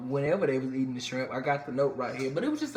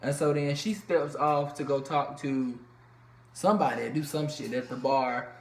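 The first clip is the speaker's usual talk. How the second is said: ordinary talk, nothing marked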